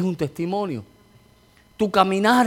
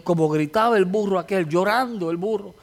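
ordinary talk, nothing marked

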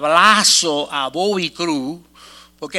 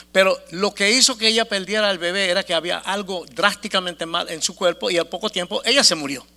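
ordinary talk, nothing marked

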